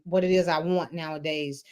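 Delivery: normal talking voice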